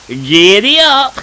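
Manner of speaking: slow, high, loud giddy up; giddy-up call. Acted. Girl dashing off from the bus stop, loud playful call. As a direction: yell it like spurring a horse.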